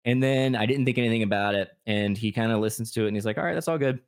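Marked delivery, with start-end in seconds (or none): 3.4-4.0 high, airy mock approval; casual approval. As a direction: pitch it high and offhand. Voices therapist's easy first reaction.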